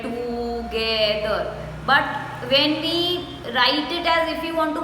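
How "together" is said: "together" stretched slowly as to-ge-ther; divided into syllables. Shown as a demonstration. Teacher contrasting with normal speech, "together" slowly divided. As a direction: draw out every syllable of "together". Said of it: "We don't divide it".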